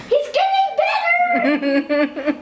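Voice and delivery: high, drawn-out comic cry; hammed-up crowd correction; protesting heckler. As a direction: butt in high, stretching the words. Heckler interrupts the king's speech.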